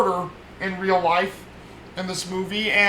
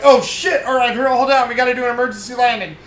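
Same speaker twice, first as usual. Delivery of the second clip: higher, louder, fast alarmed rush; alarmed outburst; 6 semitones higher, 4 dB louder, faster than usual. Retold or imitated. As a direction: blurt it out in a hammed-up panic. Pilot alarmed, helicopter hit.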